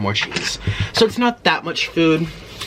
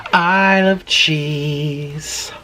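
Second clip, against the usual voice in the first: slow savouring; much slower than usual. Acted. Savouring cheese.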